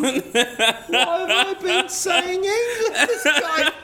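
high, loud, drawn-out mock dismay; child's outraged realisation. Host acts out the son realizing about English, mock outraged child. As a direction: wail it like a kid who's been duped. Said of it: "a real kind of moment when he realizes".

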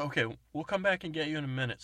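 fast, loud reassurance; hurried. Retold or imitated. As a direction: rush it out to calm him. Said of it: "I go".

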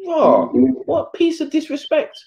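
hammed-up offended squeal; outraged disbelief. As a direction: act out being insulted. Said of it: "I'm like".

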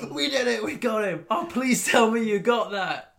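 high, loud triumphant yelling; hammed-up elated shouting; mock screaming. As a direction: yell it like you've just won the fight. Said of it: "and they're screaming".

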